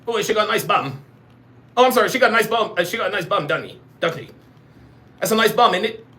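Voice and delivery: mock British banter with tag questions; British accent. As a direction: play a cheeky British bloke. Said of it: "You talking in a British accent".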